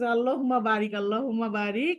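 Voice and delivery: normal talking voice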